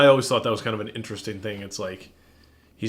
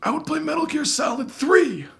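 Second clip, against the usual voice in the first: put-on much higher, strained old man voice; old guy voice; 13 semitones higher than usual. Shown as a demonstration. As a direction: talk like a creaky old man throughout. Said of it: "this old guy voice".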